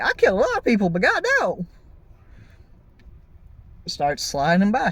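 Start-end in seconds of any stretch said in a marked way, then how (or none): 0.0-1.7 high, quick incredulous voice; hammed-up astonished killer, pitch leaping up; killer's mock amazement. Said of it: "he's like".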